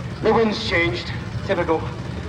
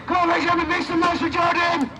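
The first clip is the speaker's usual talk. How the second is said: crowd hype; 9 semitones higher than usual. Real, not acted. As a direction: get the crowd cheering. Calling crowd to cheer.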